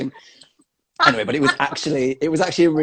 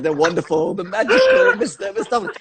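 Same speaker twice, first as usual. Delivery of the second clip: laughing mock announcer; 9 semitones higher than usual. Announcer introducing, speaker laughing.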